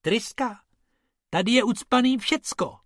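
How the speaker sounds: high, disbelieving rabbit voice; high rabbit scoffing at the engine; incredulous rabbit. Acted. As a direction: echo the word in high disbelief. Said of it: "řekl Bob".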